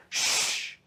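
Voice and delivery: long unvoiced shush; firm drawn-out "shh"; commanding shush. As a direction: shush the whole room, imitating a child in charge. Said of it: "she just walks in and she goes".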